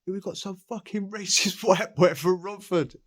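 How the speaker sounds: high, loud jeering inmate with a laugh; mocking inmate voice. Inmate calls the others about the new boy, high jeering voice. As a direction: sneer it up high like a cocky lad. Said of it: "calling up whoever".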